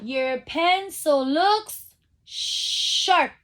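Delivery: drawn out slowly, stressing looks; slow teacher read-aloud; slow stretched reading. Shown as a demonstration. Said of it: "It begins with the same sound".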